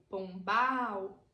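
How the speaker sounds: ordinary talk, nothing marked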